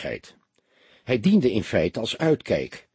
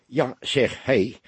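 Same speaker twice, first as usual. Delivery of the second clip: plain reading voice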